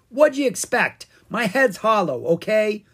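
high, loud, defensive gripe; griping. A narrator's reading. Pumpkin King excuses his bad math, griping. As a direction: gripe back, exasperated. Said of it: "the Pumpkin King griped".

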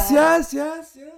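high echoing repeats; echo effect. Pretend. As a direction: bounce the syllable back. Echo gag on delícia.

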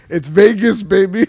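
loud, high Vegas cheer; party hype. Acted. Teasing his friend about saving it for Vegas, hyped-up shout. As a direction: shout it like a party rallying cry.

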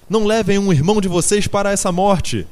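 high, loud, desperate appeal; pleading shout. A narrator's reading. Animals beg the two horses, shouting desperately. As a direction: cry out to beg them to stop. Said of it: "gritavam eles".

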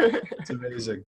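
said through a real laugh; amazed and laughing. Real, not acted. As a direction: laugh the words out, delighted. Laughing after shared connection.

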